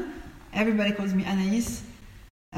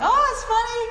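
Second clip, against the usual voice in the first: surprised; 5 dB louder, faster than usual. Retold or imitated. Retells her own surprised reaction.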